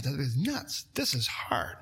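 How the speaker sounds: whispered, breathless with awe; awed whisper. Real, not acted. Preacher to congregation about Paul's beatings, stunned whisper. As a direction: hush it as if the hardship stuns you.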